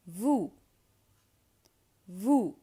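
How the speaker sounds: "Vous" as voo, silent final s; oo vowel demo. Shown as a demonstration. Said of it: "oo sound".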